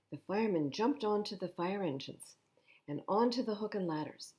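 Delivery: gentle storybook reading; reading to children. Acted. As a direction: read it like a bedtime picture book. Reads picture book aloud.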